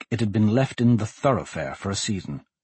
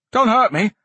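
put-on high, louder boyish plea; alarmed boy; 11 semitones higher, 5 dB louder than usual. A narrator's reading. Boy pleads with men holding swords, alarmed high voice. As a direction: yelp it like a startled lad.

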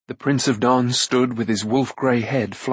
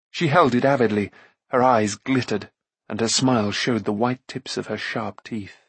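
plain reading voice

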